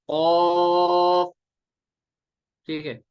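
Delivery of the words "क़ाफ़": "ऑफ" sustained long and loud; three-alif elongation. Shown as a demonstration. Teacher leading students to read along, drawn out. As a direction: hold the vowel of "ऑफ" on one note. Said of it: "आप भी पढ़िए".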